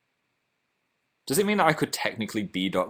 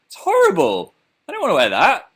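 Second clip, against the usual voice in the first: 1 disgusted whine; 11 semitones higher, 7 dB louder than usual. Real, not acted. Disgust at thong flip-flops.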